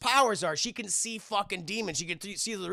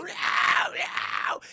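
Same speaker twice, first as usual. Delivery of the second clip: strained, voiceless mock howl; hammed-up dying wail; imitated demon screams; 11 semitones higher than usual. Demons set on fire, imitated screams.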